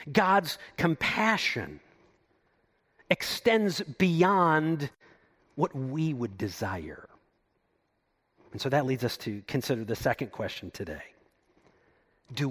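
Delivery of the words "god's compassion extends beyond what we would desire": very slow, weighted words; preacher slowing to land the point; slow emphasis. Real, not acted. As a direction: stretch each word out for weight. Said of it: "slowed for emphasis".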